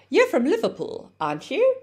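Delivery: "aren't you" lifting high at the end; statement turned into a question by a rising "aren't you"; rising tag question. Shown as a demonstration. English teacher models a friendly question to keep conversation going, rising intonation. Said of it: "if your intonation rises".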